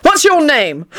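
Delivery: high-pitched indignant question; indignant demand. A narrator's reading. Angry boss confronting Tony.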